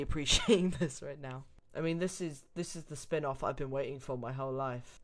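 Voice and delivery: normal talking voice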